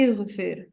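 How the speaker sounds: اغفر said as "ighfir", starting with a short i; zer on the alif. Shown as a demonstration. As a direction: read اغفر as "igh-fir", i on the alif. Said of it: "میں اس کو پڑھوں گی".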